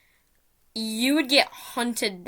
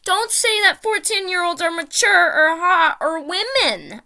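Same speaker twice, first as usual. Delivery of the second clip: building to a loud, much higher furious yell; retold outraged rant at a gamer, getting louder; angry rant; 8 semitones higher, 8 dB louder than usual. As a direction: lecture them in rising outrage until you're shouting. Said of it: "started going on a whole rant".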